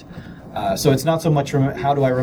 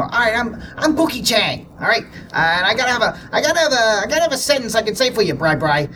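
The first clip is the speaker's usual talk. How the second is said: high, quick cartoonish character voice; Pookie Chang; 8 semitones higher than usual. Imitating a podcaster warming up before recording, high character voice. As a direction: pitch it way up, rattling along in character. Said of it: "before he starts to record".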